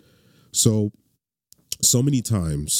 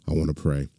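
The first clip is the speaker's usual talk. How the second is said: normal talking voice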